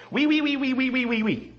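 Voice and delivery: a run of French oui sounds, lightly lilting; mock French. Shown as a demonstration. [name] quizzing Andy on real French, in hammed-up French. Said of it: "See if you can tell me what this means".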